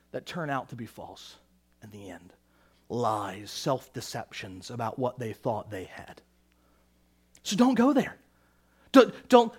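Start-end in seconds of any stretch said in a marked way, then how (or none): 0.1-6.2 low, very soft preaching; hushed, weighty pulpit conclusion; grave hush. Real, not acted. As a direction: drop right down for a sober warning. Preacher's grave conclusion after forceful warning.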